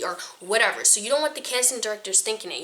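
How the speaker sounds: normal talking voice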